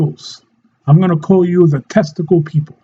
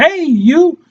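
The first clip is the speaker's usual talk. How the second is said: high-pitched mock hail; calling out; 8 semitones higher than usual. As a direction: call out as if across a coffee shop. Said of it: "I see it all the time in Starbucks".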